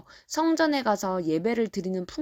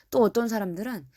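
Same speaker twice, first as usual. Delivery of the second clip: ordinary delivery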